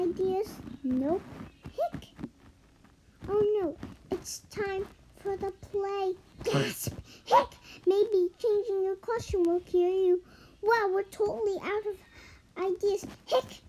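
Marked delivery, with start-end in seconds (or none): 1.6-2.1 high, soft squeaky hiccup; a tiny high hiccup. Acted. Character has hiccups. 7.2-7.5 hiccup. Acted. Character has hiccups. 13.3-13.6 hiccup. Acted. Character has hiccups.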